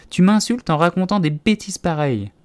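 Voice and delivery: high, loud indignant scolding; heated, high-pitched reproach; offended outburst. A narrator's reading. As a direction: accuse her as the insulted mouse. Offended mouse quarrels with Alice.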